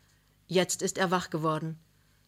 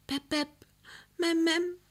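put-on much higher little child's babble; toddler calling; 11 semitones higher than usual. A narrator's reading. Small child calling from bed, high babble. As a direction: call out like a small child half asleep. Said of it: "Drinnen ruft der Murkel".

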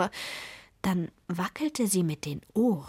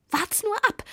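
very high, angry witch snarl; spiteful high snap; hissed threat; 14 semitones higher, 3 dB louder than usual. A narrator's reading. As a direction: spit it out at a high pitch. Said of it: "fauchte die Zwickurlaub".

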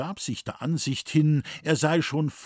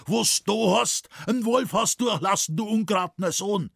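scolding father, high and loud; heated, high-pitched outburst; angry shouting; 9 semitones higher, 4 dB louder than usual. A narrator's reading. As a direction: berate your wayward son in dialect. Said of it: "So schreit nur nicht so".

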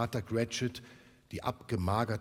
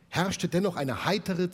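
usual speaking voice

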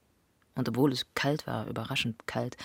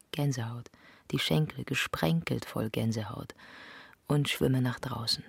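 ordinary delivery

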